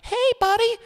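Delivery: high, sugary fake-kind voice, talked up; mock-friendly orderly. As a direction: coax a patient into the padded room. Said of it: "and they're like".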